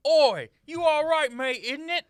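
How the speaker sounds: loud, brash cockney geezer; cockney Prince Charles. Acted. The prince fussing over his mother, in brash cockney. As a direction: bark it out in cockney. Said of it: "accent work in this too".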